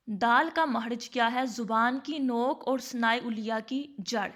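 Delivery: usual speaking voice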